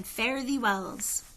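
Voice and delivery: plain reading voice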